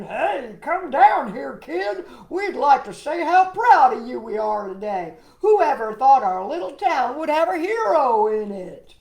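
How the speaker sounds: loud, proud calling up to the sky; shouting mayor. Acted. Mayor shouting up to the flying kid, loud and proud. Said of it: "Mayor Lowry shouted".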